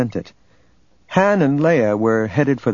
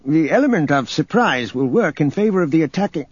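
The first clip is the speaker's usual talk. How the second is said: higher voice, carefully reasoning; careful argument; 6 semitones higher than usual. A narrator's reading. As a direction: make the case carefully, pitched up. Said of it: "Thanos said carefully".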